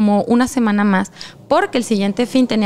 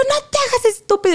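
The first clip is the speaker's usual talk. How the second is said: high-pitched retold accusation; acted-out exasperated scolding; indignant confrontation; 12 semitones higher than usual. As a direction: call out a cheater, voice jumping high. Retold angry confrontation by phone.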